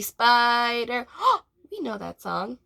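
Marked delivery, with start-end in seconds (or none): none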